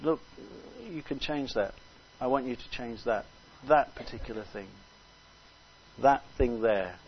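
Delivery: softly voiced, pointed request; the Lord. God quietly asking a believer to change something, softly voiced. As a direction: speak very quietly, pointing out one thing. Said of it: "the Lord puts his finger on something and says".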